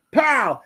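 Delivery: high "Pow!"; mock gunshot. Imitating dad's rifle shot, high mock pow. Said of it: "He's like".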